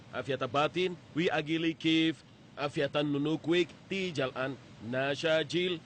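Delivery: foreign phrase repeated slowly and deliberately; slow careful recital. Shown as a demonstration. To the Dinka porter, trying the phrase again slowly. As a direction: lay out each learned word slowly for the porter. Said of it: "Saya bilang".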